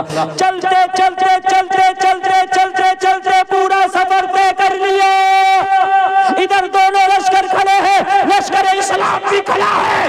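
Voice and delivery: high, loud sermon cry of equality; fiery preaching. Acted. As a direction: proclaim it to the whole crowd at full force. Preaching at full force.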